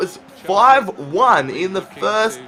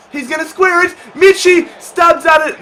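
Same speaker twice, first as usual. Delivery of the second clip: higher and louder, yelling the chance; excited shout; 10 semitones higher, 5 dB louder than usual. Real, not acted. Gamer watching his striker's shot, excited shout. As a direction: caught up in the moment. Goal chance building live.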